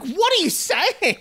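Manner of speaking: high, slow disbelieving cry; stunned, drawn-out what; incredulous. Retold or imitated. Incredulous reply to offensive remark.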